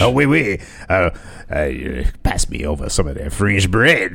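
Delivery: low mock-French accent. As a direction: put on a silly French accent. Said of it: "Seven French".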